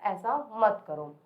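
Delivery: ordinary talk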